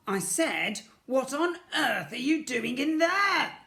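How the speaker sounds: loud, slow angry yell through the door; shouting sister. A narrator's reading. Sister shouting at Ned through the door, loud and angry. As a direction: yell it at the locked bathroom door. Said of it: "came another shout".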